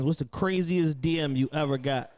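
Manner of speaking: ordinary talk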